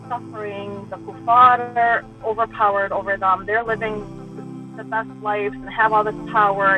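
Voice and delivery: ordinary talk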